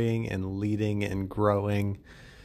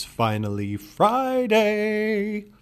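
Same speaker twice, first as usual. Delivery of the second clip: drawn-out announcer call; 11 semitones higher, 5 dB louder, much slower than usual. Acted.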